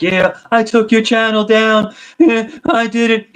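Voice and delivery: high, drawn-out mocking impression; high-pitched mimicry. Cohost mocking the troll who took a channel down, high-pitched mimicry. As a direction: mimic him slowly in a squeaky high voice. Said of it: "he has, like, a really high-pitched voice".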